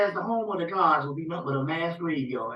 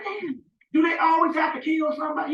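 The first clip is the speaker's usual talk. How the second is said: exasperated outburst; 9 semitones higher than usual. Retold or imitated. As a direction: throw it up in frustrated disbelief. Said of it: "I'm like".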